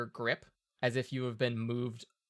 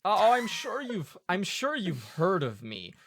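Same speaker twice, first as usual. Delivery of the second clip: vain poet voice; 6 semitones higher, 3 dB louder than usual. Acted.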